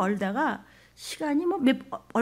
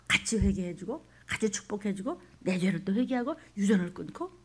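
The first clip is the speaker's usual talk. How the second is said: whispered prayer; 3 semitones lower, 8 dB quieter than usual. Real, not acted. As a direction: pray it under your breath. Whispered sermon passage.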